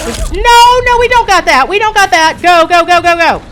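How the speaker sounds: high, loud alarmed cries; panicked yell. Real, not acted. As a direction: shout as the plan collapses. Panicked yelling as fight fails.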